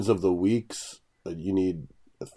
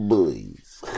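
sad cartoon dog voice; 6 semitones lower, 4 dB louder than usual. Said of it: "You said it in the dog voice".

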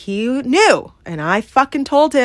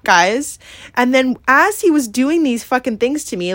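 ordinary talk, nothing marked

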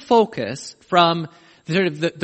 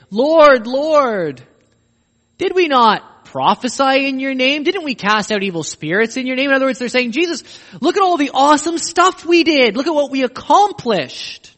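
loud and higher, insistent begging; pleading; 7 semitones higher, 6 dB louder than usual. Acted. Crowd pleading with the Lord, loud insistent begging. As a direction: boast and beg for entry.

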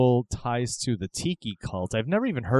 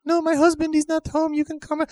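very high mock-female falsetto; flirting wife; 4 dB louder than usual. Wife inviting lover over, hammed-up falsetto coo. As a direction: sing out sweetly as a cartoon wife.

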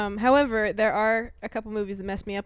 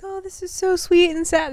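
mock sentimental gush; 7 semitones higher, 5 dB louder than usual. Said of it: "It's not a crying like".

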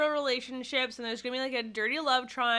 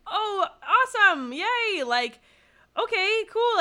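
higher, bright upbeat cheer with a laugh; mock supportive girlfriend; 8 semitones higher, 3 dB louder, slower than usual. A girlfriend supporting her partner, higher and upbeat. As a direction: cheer him on lightly. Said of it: "Being like".

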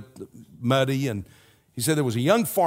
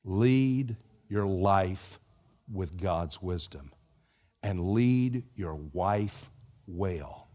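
earnest closing appeal; 6 semitones lower, 6 dB quieter, slower than usual. Acted. Slow soft closing exhortation.

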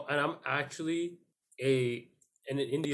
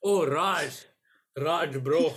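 put-on louder Indian accent, pleased; 3 semitones higher, 5 dB louder, much slower than usual. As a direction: greet Raj in Indian accent. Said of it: "In an Indian accent".

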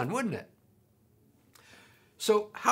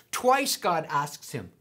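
ordinary delivery

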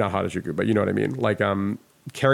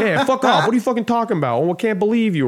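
high, loud, brash put-on voice; sassy foul-mouthed friend; 10 semitones higher, 3 dB louder, faster than usual. Impersonating a rude cigarette-smoking friend, high sassy outburst. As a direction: swear with loud, high-pitched sass. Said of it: "imagine my dad's voice, just a Japanese girl".

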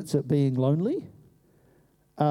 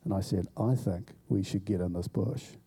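hushed hiding; 7 semitones lower, 6 dB quieter than usual. Retold or imitated. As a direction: lower and softer, two friends hiding. Hiding in the bush.

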